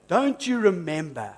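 ordinary delivery

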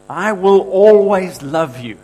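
slow, loud, high heartfelt promise; tender promise. Acted. Preacher voicing Jesus' promise to the crowd, slow and loud. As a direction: lean into always, slowly.

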